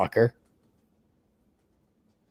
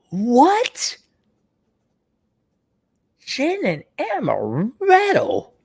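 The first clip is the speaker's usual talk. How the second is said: high, drawn-out horrified squeal; disgusted shock; 10 semitones higher, much slower than usual. Real, not acted. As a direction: recoil at the drink. Reacting to gin and amaretto.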